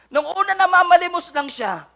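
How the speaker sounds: high, loud, slow pleading cry; fervent preaching. Acted. Preacher voicing a sinner's cry, loud and pleading. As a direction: plead to God at full force.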